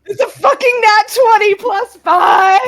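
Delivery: yelling with joy; excited shout. Real, not acted. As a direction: shout it out in triumph. Big roll celebrated.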